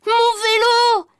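high, loud, drawn-out wail; stretched, shouted childlike lament; anguished child's cry. A narrator's reading. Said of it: "j'ai crié".

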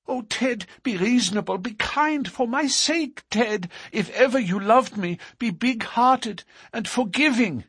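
very high, beseeching woman's voice; distraught pleading. A narrator's reading. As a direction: beg an angry man for mercy. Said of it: "distracted Etty".

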